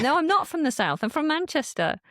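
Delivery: high-pitched, insistent retort; mock protest. Northerner taken for a southerner, high mock protest. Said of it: "I'm like".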